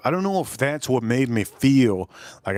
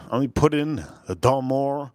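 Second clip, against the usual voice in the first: ordinary talk, nothing marked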